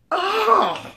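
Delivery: high-pitched shocked "oh"; mock offended gasp. Insulted woman reacting to his request, mock shock. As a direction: imitate a woman taken aback.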